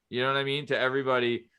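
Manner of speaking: usual speaking voice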